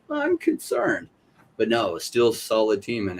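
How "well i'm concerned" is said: very high, slow fake-worried voice; squeaky hammed-up worry; mock concern. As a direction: play it up as an overly fretful investor. Imagined worried reaction.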